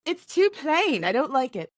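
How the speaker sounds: put-on Mary Poppins style British accent; American faking a posh English accent. Co-host teasing his plain voice, in mock British.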